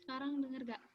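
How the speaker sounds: suara lirih lewat mikrofon lemah; barely audible. Real, not acted. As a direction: hampir tak terdengar. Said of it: "Masih kecil". (suara kecil sekali)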